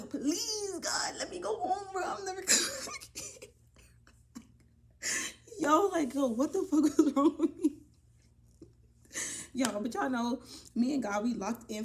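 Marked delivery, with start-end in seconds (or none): 0.0-3.5 high, whiny tearful begging; mock wailing prayer. Storyteller mimicking herself praying again to go home, high mock-crying plea. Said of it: "praying to God". 5.6-7.7 loud, high incredulous laughing; laughing disbelief. Real, not acted. Storyteller asking what was wrong with her, laughing out loud.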